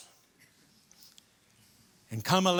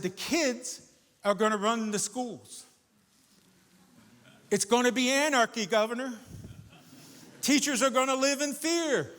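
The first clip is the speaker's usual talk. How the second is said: higher, hammed-up warning of doom; mock alarm; 8 semitones higher than usual. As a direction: paint the chaos with playful exaggerated dread. Dramatising anarchy to persuade governor.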